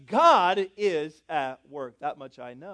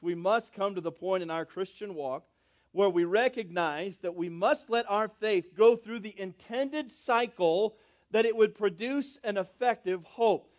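ordinary talk, nothing marked

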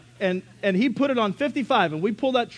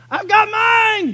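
slow, much higher, louder gloating yell; 10 dB louder, much slower than usual. Retold or imitated. Dad yelling out the window, slow gloating shout. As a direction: stretch it out, shouting to the speeder. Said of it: "He'd yell out the window".

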